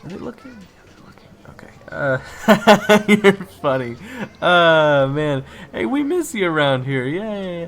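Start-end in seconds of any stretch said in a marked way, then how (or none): 0.5-1.7 much softer, breathless aside; hushed worried whisper. Acted. Waiter [name] whispering that people are watching, worried. 1.9-7.7 much louder put-on laughter and chatter; loud fake laugh. Waiter [name] faking a laugh for onlookers, loud.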